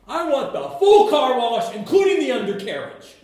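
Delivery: high, loud eager demand; overeager, asking for everything; eager Peter. Retold or imitated. Peter begging Jesus for a full wash, loud and high. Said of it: "That's what he basically said".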